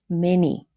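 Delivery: many stressed on the first syllable MA-ny; trochee stress. Shown as a demonstration. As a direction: hit MA in many, let ny fall away. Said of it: "the first syllable stressed".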